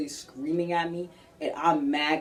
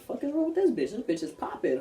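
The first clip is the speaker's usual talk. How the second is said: outraged disbelief; 6 semitones higher than usual. Real, not acted. Outraged reaction to the rumor.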